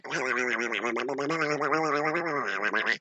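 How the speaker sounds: long, soft wobbling warble; rapid rolling babble, held long; twirling head noise. Acted. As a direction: imitate something spinning round and round. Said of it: "kept twirling his head".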